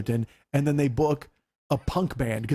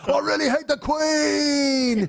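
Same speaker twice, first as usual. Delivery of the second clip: much higher, slow, shouted punk snarl; 8 semitones higher, slower than usual. Retold or imitated. Punk singer announcing hate for the Queen, slow shouted snarl. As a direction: yowl it like a sneering punk singer. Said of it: "he's totally [name] out".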